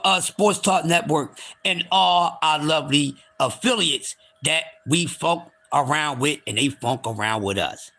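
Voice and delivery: normal talking voice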